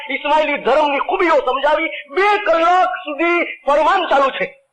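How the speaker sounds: rising sermon fervor. Acted. Preacher building up to the congregation.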